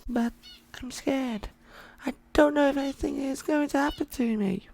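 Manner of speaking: put-on high, soft, scared boy voice; a frightened kid who doesn't want to be left; voiced young boy. Injured boy telling Leonard, quietly scared. Boy robot admits fear.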